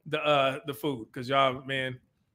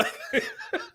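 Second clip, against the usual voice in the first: breathy high-pitched laugh bursting out; 8 dB quieter than usual. Real, not acted. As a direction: let a high wheezy laugh escape. Laughs at Buc-ee's billboard.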